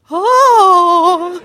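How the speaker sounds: loud, high mock-horrified mom; terror. Mom hearing about blood popsicles, imitated terror. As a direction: imitate an appalled mother's long, high "oh". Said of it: "you could hear the terror in my mom's voice".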